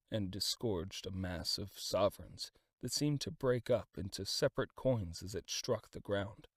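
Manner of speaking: ordinary delivery, nothing marked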